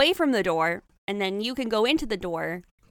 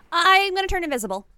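excited; 8 semitones higher, 6 dB louder than usual. Real, not acted. Excited plan announcement.

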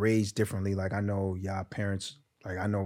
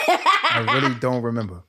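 laughing; 2 semitones higher, 7 dB louder than usual. Real, not acted. Laughing evasive answer.

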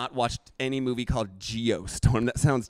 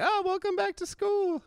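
high singsong teacher greeting; sugary teacher welcome back; third grade teacher; 4 dB quieter than usual. Retold or imitated. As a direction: greet the class like a chirpy teacher. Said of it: "you're a third grade teacher and you're like".

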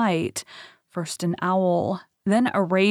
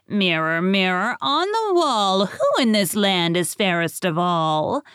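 vain queen voice. Acted. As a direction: call to the mirror like a proud queen. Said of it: "she stepped before her mirror and said".

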